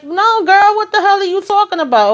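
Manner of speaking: a high shocked protest, as if she can't believe it; high incredulous retort. Retold or imitated. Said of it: "She like".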